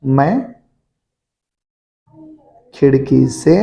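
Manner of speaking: very slow, a pause after every word; slow dictation. Shown as a demonstration. Teacher dictating the sentence to pupils, word by word. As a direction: dictate so the pupils can write along. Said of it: "तो मैंने को लिख दो बेटा मैं".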